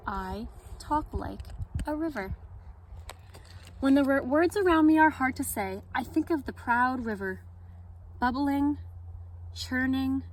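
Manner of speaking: gentle read-aloud storytelling; story narration. A narrator's reading. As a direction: read it like story time for kids. Reads picture book at story time.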